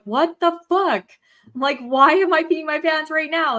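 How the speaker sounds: mock outburst of exasperated bafflement; exasperated disbelief. Baffled at own unpredictable bladder, exasperated.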